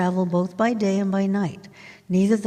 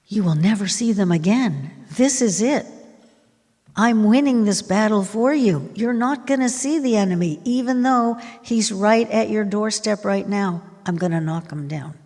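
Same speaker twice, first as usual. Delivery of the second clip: forceful, raised preaching voice; emphatic promise; 3 semitones higher, 3 dB louder than usual. Acted. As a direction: drive the promise home as the champion fighting for them.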